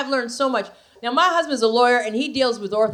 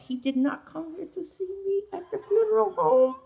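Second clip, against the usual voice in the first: high, soft mocking voice; 10 semitones higher, 5 dB quieter than usual. Comic mocking the ex at his funeral, high soft sneer. Mock voice of dead ex.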